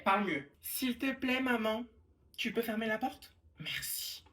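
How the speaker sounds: ordinary talk, nothing marked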